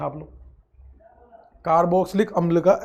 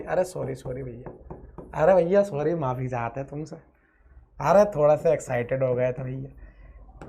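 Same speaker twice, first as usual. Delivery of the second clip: suddenly soft, lowered sorry; mock-sheepish apology; 6 semitones lower, 5 dB quieter than usual. Teacher apologises to students for shouting, mock-sheepish and soft. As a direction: drop right down into a hushed apology.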